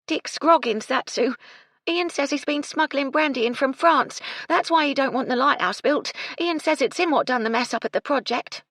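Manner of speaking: high, loud working-class maid's voice; worked-up servant girl telling on someone; distressed housemaid. A narrator's reading. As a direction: blurt it out, high and agitated.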